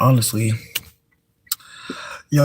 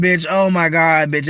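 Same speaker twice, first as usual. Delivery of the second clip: normal talking voice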